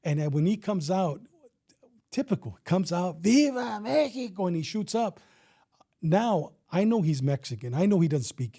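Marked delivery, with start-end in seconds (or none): 3.2-4.5 high, long-drawn mock holler; mock drunken battle cry. Drunk gunman comes out to shoot, imitated battle cry. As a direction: stretch out an imitated rowdy cry.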